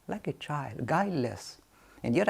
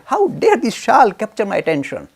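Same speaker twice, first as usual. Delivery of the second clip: louder, indignant rant; annoyed outburst; 7 semitones higher, 9 dB louder than usual. Retold or imitated. Ramakrishna furious at the shawl, loud and indignant. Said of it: "He was so annoyed".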